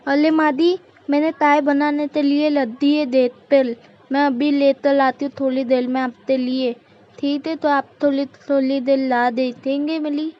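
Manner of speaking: put-on babyish lisp, explaining herself; childlike, pleading lisp; lisping daughter-in-law. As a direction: swap r for l and k or ch for t. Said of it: "तोतली बहू".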